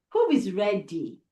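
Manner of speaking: ordinary delivery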